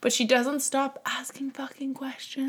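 slow exasperated whisper; whispered complaint. Real, not acted. Complaining about daughter's endless questions.

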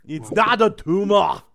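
put-on loud, slow, high Austrian-accented protest; hammed-up Austrian action hero; Schwarzenegger. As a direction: bellow the catchphrase in an Austrian accent. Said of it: "do a Schwarzenegger impersonation".

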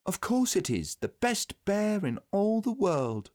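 put-on high, boyish enthusiasm; delighted praise. A narrator's reading. Christopher Robin naming Pooh best bear, high and delighted. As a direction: declare it high, stretching the final praise.

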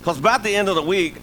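normal talking voice

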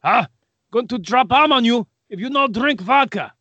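jokey exaggerated Russian accent; mock Russian accent. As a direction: play a cartoon Russian threatening with a bomb. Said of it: "What's the thing a Russian would say?".

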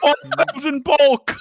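mock store clerk voice. Guy selling cards at store.

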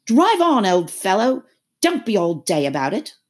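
high, loud start, then settling; brusque creature hurrying a friend; impatient Gryphon. A narrator's reading. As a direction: urge on brusquely. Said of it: "the Gryphon said to".

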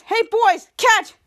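loud, high, quick call. Acted. Mitch throws to his bottle snatchers, calling out. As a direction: shout it to your henchmen.

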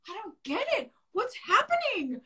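high, thin panicked voice; retold panic. Recalling a screaming boss, high panicked voice. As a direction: squeak it out like you're lost and scared. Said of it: "I was, like, panicking".

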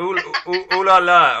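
high, loud French "ooh la la"; playful French. Pretend. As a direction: ham up a flirty French exclamation, loudly. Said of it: "you could speak French to me anytime".

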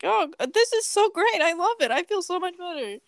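high, bright gushing, pretending all is great; mock cheerful relief. Retelling her heat stroke to a friend, high delighted gushing. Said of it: "and I was like".

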